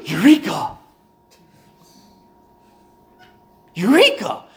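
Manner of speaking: a sudden delighted cry of discovery; excited eureka. Retold or imitated. Pastor acting out his own realization, excited cry. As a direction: shout it like a eureka moment.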